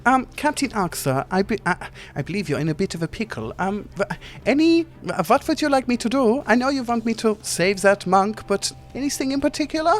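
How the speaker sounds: squeaky little genie gushing over Titus; small Germanic genie. Acted. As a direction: play a tiny fussy genie, flustered. Genie asks Ark.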